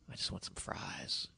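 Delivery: slow, hushed whisper; whispered quote. Retold or imitated. Recounting a late hotel craving to the host, whispered. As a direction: whisper it slowly, almost no voice. Retelling a private craving for fries.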